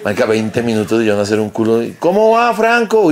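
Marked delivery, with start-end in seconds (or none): 2.0-3.1 high, louder mock voice asking; hammed-up high-pitched question; imitating the worried rival cook; mimicking Camilo.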